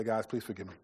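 put-on father apologizing to his kids; humble apology. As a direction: ask the family's forgiveness humbly. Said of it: "humble myself and say".

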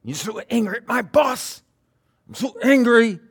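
high-pitched, loud mock fury; acted angry venting. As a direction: rant as if fuming about the boss. Acts out venting about boss.

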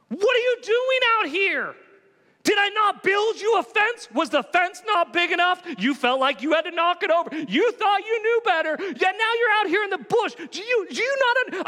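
high, sharp scolding of a stray animal; mock scolding. Scolding himself like a lost sheep.

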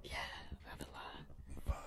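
very soft, slow whisper. Real, not acted. Podcast guest trying to keep an aside off the mic, whispering. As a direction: whisper it under your breath, drawn out. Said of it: "I'm trying to whisper".

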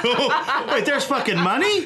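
high, laughing mock shock; mock surprise. Cohost joking about pay in high mock surprise. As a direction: squeal it as if stunned.

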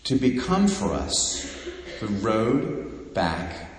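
usual speaking voice

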